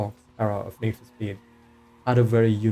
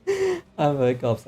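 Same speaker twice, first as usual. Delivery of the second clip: laughing through hiccups, higher; 7 semitones higher, 3 dB louder, slower than usual. Real, not acted. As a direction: giggle while hiccuping.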